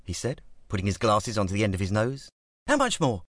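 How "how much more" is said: alarmed East London. A narrator's reading. Fred alarmed.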